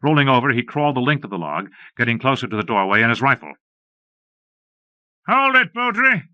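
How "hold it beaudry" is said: high, loud call from the brush. A narrator's reading. Gunman in the brush shouting at the ranger, loud and high. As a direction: bark the order across the clearing. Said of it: "a voice called".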